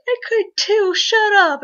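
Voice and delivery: high, loud comeback in play; mock indignant retort. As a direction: fire back like a teased actor. Acted playful comeback between voice actors.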